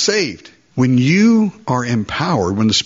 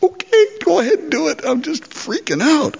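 much higher, strained, jittery go-ahead; nervous consent. Acted. Believer letting the Lord act, nervous high consent. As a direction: say it like you're bracing yourself.

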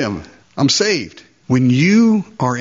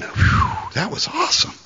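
breathless relief; 14 semitones higher than usual. Acted. Relieved thrill after the Lord acts.

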